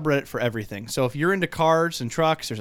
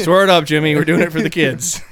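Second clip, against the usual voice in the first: mock hype; 3 semitones higher, 9 dB louder than usual.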